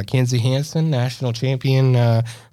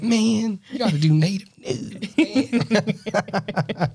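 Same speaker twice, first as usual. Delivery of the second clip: much higher, pleading buddy voice; imitating Mark; 10 semitones higher, much slower than usual. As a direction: beg him like an eager friend. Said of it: "He's like".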